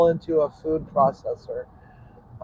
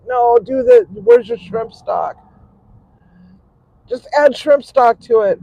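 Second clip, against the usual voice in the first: mock yell at the phone, higher and louder; retold yelling; 8 semitones higher, 7 dB louder than usual. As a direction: replay shouting advice at the screen. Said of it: "I was, like, yelling, yelling at my frickin' phone".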